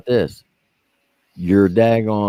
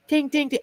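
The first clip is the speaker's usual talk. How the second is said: hammer tapping sound; 3 dB quieter than usual. Retold or imitated. Imitating hammer on armor.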